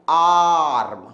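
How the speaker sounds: "आर्म" held long on a low "aa"; drawn-out long vowel. Shown as a demonstration. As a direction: stretch the "aa" in "आर्म" for students to repeat. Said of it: "हम word को खींच रहे हैं". ("आर्म" का "आ" खींचकर)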